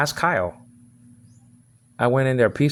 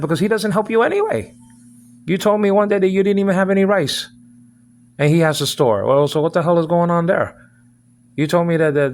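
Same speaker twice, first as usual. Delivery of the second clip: louder, higher, accusing; rising anger, pressing her hard; heated confrontation about the rice; angry rant; 5 semitones higher, 3 dB louder than usual. Real, not acted.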